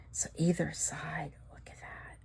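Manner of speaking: hushed whisper of wonder; awed whisper. Real, not acted. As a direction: whisper it in awe. Whispering in awe.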